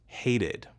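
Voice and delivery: "Hated" with an extra syllable id. Shown as a demonstration. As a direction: say "Hated" in two syllables, ending id. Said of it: "in hated".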